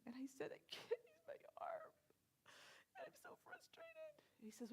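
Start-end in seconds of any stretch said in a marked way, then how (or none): 0.5-1.9 high, teary retold sob voice; tearful plea. Telling the tall man her trouble, high teary voice. 2.9-4.1 high, whispered, crying retelling; tearful whisper. Retold or imitated. Telling the tall man why she cries, high tearful whisper.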